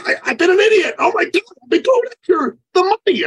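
high, loud mock confession; remorseful client. Client confessing to his coach, high and loud. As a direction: blurt out the realization.

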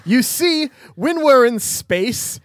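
high, loud, badly faked British sleuth; yelling British accent. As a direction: shout it in mock-British. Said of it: "He's just yelling".

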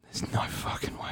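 breathy whisper of disbelief. Real, not acted. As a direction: whisper it in shock. Disbelief, unvoiced.

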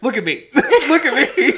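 high, laughing mock bouncer; imitating bouncer. Imitating the bouncer who pushed her aside, laughing high voice. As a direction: squeak it through laughter, mock tough. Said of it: "It's like".

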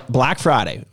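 ordinary delivery

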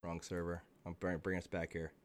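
ordinary talk, nothing marked

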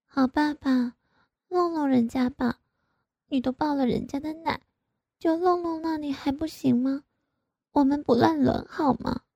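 high, soft wheedling plea; pleading. A narrator's reading. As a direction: beg him sweetly. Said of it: "我就央求着". (软声央求)

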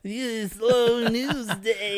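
high, loud, slow late-night host voice; a TV host doing another host; Jon Stewart doing Johnny Carson. Acted. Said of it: "doing Jon Stewart doing, uh, Johnny Carson".

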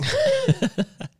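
squeaky burst of laughter; high squealing laugh. Real, not acted. Laughing at the stupid part.

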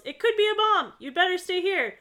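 high, loud shout of alarm; hammed-up panicked order; urgent yell. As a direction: yell a warning across the house. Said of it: "Carson immediately yells".

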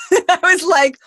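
high, laughing "what"; a startled laughing what; laughing shock. Retold or imitated. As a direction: blurt out stunned disbelief. Shocked disbelief retold.

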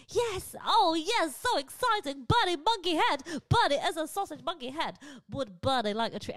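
loud, high, thrilled puppy voice; the dog squealing yes to a treat; excited dog voice. Pretend. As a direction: answer as the overjoyed dog. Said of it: "a voice for their dog".

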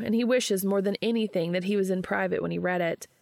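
plain reading voice

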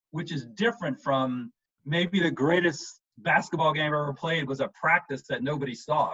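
usual speaking voice

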